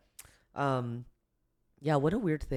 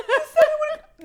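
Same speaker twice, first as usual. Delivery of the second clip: much higher and louder, laughing out the words; laughing through tears; 12 dB louder than usual. Real, not acted. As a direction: burst into teary laughter. Teary laughing accusation continues.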